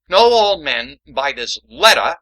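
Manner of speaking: slow, grand herald reading of "Know"; formal proclamation. Acted. [name] restarts the letter as a herald. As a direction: proclaim it like a royal decree. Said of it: "Let's start over".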